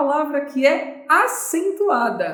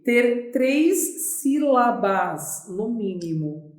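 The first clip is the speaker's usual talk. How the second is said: slow emphasis; slower than usual. Shown as a demonstration. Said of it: "eu preciso o quê?".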